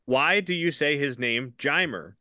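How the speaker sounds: ordinary delivery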